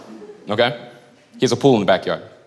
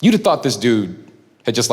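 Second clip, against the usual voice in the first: normal talking voice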